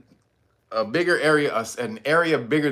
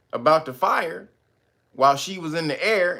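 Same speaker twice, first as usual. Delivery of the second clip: normal talking voice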